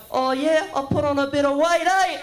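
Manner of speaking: high, loud, cheeky put-on voice; cheeky Islander driver. The big driver teasing his passenger about the photo, high and cheeky. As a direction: tease the passenger with a grin.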